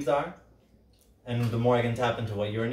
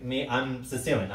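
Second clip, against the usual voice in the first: ordinary talk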